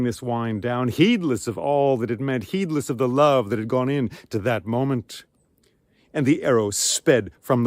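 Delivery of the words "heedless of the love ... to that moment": ordinary delivery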